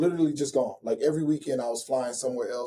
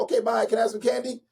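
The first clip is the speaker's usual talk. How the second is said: put-on higher, hurried little-girl voice; young daughter's voice; 8 semitones higher than usual. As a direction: imitate an eight-year-old daughter on the phone. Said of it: "She went".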